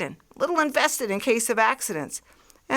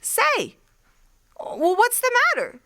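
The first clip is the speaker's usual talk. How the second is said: high, quick, caught off guard; startled calling after. Acted. Artilleryman startled as the stranger leaves, calling after him high and quick. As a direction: call out as he walks away from you.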